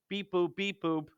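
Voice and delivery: soft beep boop calculator noises; playful computer sounds; robot beeps. Pretend.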